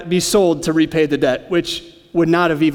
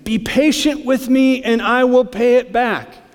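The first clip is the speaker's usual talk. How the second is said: slow, higher pleading voice; begging; 5 semitones higher, slower than usual. A narrator's reading. Fellow servant begging on his knees, slow and pleading. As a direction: beg him for patience. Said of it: "begged him".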